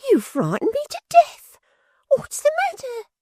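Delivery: very high, put-on shocked falsetto; affected astonishment. A narrator's reading. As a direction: fake a gasp of shock. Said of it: "in affected astonishment".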